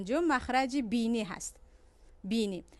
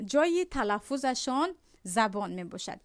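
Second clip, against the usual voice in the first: normal talking voice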